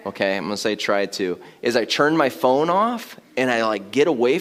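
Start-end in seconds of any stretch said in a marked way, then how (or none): none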